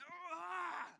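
high-pitched drawn-out "ah"; exasperated noise. Shown as a demonstration. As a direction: let out a wordless, high outburst. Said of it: "Have you made this noise".

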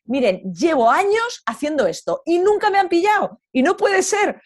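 high, loud gushing praise; cheerful woman praising the rangers who caught her; mock congratulating. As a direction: congratulate them with exaggerated delight. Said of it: "dándoles la enhorabuena".

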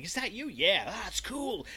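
put-on high, slow little kid voice. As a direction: gush like an impressed little kid. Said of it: "the kid's going".

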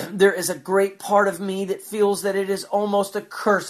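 ordinary talk

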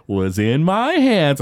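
drawn-out, teasingly slow retelling; slow, deliberate mock answer. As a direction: stretch each word to keep Dad waiting. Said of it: "Bart slows it down".

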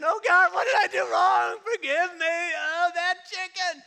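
high, loud hammed-up wail; mock wailing. Sick believer begging God, hammed-up loud wail. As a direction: cry out to God in fake agony.

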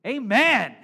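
loud, high shout from the back; shouted amen. Retold or imitated. Congregant at the back calls out, loud shout. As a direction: call it out across the room. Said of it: "the guy shouts out from the back".

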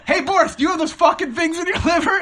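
high, loud comic outburst through laughter; panicked demand. Retold or imitated. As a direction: retell it as an alarmed, high-pitched demand. Acted panicked question to the technician.

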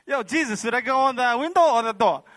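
high, loud, excited call; calling out eagerly. Retold or imitated. Dreamer asks Jesus which way out, calling eagerly. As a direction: holler the question across to someone.

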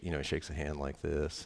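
usual speaking voice

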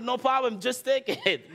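high imitated lady, breaking into laughter; laughing. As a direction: laugh through her eager offer. Woman voiced, laughing.

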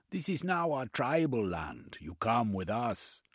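high, commanding tribeswoman claiming her land. Acted.

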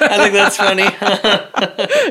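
slow, laughing through the words. Real, not acted. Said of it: "I can laugh about it now".

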